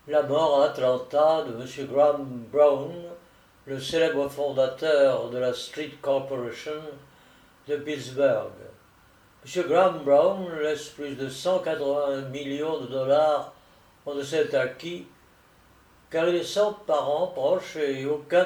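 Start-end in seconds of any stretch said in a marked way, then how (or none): none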